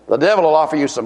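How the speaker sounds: ordinary talk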